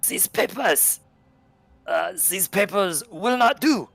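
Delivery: put-on slow, high, gruff German-accented English; thick German accent. Conductor to the players, thick German accent. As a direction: bark it out in a heavy German accent. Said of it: "in thick accented German, or English".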